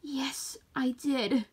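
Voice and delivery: soft, tearful whisper. A narrator's reading. Sheep admitting she liked the daisies, a soft tearful whisper. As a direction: admit it under your breath.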